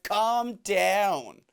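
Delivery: slow, drawn-out mock exasperation; imitated annoyed woman. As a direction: stretch each question out, put-upon and hammed up. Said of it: "And she's like".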